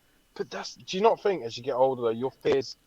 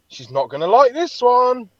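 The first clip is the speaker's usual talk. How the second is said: inner worry; 11 semitones higher, 8 dB louder than usual. Retold or imitated. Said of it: "I'm thinking".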